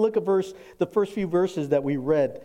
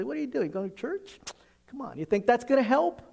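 higher, scoffing disbelief; mocking family member; 8 semitones higher than usual. Family scoffing at someone going to church, higher mocking scorn. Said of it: "he uses people around you to do that".

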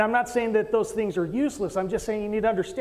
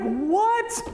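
stunned what; 12 semitones higher than usual. Retold or imitated. Stunned at friend's reply.

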